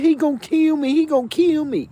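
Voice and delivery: high, frantic pleading in a mocking voice; mock panic. Cellmate begs the guards not to open the door, mock panic. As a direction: play the scared cellmate, hammed-up.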